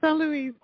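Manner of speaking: lifted pitch, nasal French "Saint Louis", silent T; French pronunciation. Shown as a demonstration. Guest repeats the name host liked, French pronunciation. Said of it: "I like your pronunciation though".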